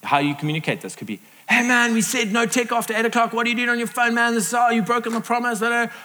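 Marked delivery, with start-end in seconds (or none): 1.5-5.9 high-pitched, ranting mock complaint; frustration vomiting out. Acted spouse venting about the phone, frustrated rant. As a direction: spew out the grievance in a raised pitch. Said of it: "Frustration, just vomiting out".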